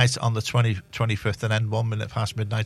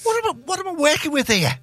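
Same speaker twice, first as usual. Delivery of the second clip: exasperated; 14 semitones higher than usual. Real, not acted. Exasperated at his co-hosts.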